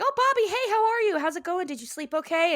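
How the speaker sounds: very high, chirpy mother voice; high-pitched gush easing down by the end; imitated eager chatter. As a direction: play an over-eager mom greeting her son. Voicing Peggy chirping at Bobby.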